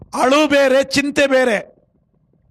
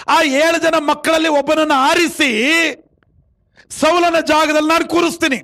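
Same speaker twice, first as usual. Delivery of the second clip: sustained high sermon cry, endings stretched; forceful preaching; 4 semitones higher than usual. Acted. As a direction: exhort the congregation point by point. Preaches God's instruction to Samuel. (ಏರುದನಿಯ ಪ್ರವಚನ)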